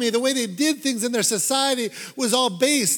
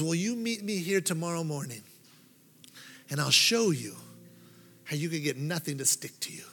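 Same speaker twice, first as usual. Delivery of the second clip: hushed secretive offer; 5 semitones lower, 8 dB quieter than usual. Acted. Wicker woman quietly tempting the dotted boy with a secret. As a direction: drop to a soft, low near-whisper.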